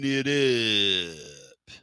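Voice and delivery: slow, drawn-out "looking it up"; a wink at the old teacher, stretched out; teacher's catchphrase. Pretend.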